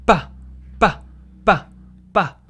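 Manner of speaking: pa with a tense, hard start. Shown as a demonstration. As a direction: snap pa out with force. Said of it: "And then this one's like".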